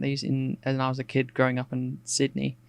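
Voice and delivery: ordinary talk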